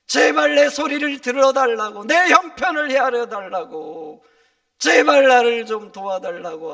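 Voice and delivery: loud, high, pleading shout; acted desperate outcry; crying out for help. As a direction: beg at full voice to be heard. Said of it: "사람들이 소리를 칩니다".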